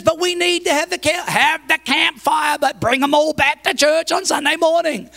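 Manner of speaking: high, loud-edged protest; acted-out quarrel; heated arguing. Retold quarrel over camping.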